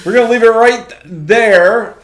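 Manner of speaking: loud, high and drawn-out; big sign-off. Real, not acted. Co-host wrapping up the show, loud and stretched out. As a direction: belt it out slowly like an announcer closing.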